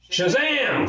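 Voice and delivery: sudden high yell of a magic word. A narrator's reading. Girl bursting into the story with a yell. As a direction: shout it like a comic hero. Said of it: "Gladys yelled".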